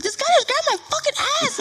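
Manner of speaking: very high, fast, worked-up complaint; outraged tattling. Retold or imitated. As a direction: re-enact urgently telling your brother. Re-enacts complaint to brother.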